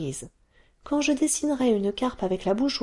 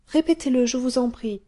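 put-on higher, louder, eager child asking; eager plea; 7 semitones higher, 4 dB louder than usual. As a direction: beg him brightly to do it again. Taffy asks again.

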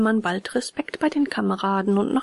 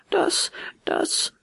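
put-on high, hesitant boyish stammer; 9 semitones higher than usual. A narrator's reading. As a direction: falter and repeat, pitched high. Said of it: "stotterte Bengele".

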